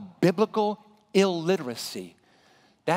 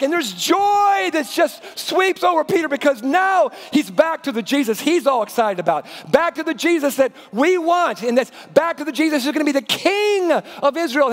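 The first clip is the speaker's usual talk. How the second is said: ordinary talk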